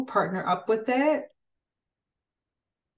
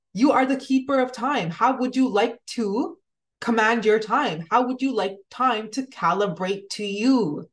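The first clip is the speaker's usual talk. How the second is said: ordinary delivery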